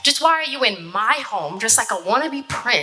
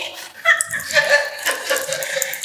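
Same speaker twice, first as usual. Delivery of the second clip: breathy high laugh. Real, not acted. Laughing at his boasts.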